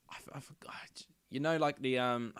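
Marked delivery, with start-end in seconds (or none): 0.1-1.1 breathy whisper while searching memory. Real, not acted. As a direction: whisper to yourself, trying to remember. Trying to recall, under breath.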